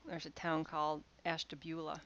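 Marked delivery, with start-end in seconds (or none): 1.2-2.0 Ashtabula said the Ohio way, ash-tuh-BYOO-luh; local pronunciation. Shown as a demonstration. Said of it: "it's pronounced Ashtabula".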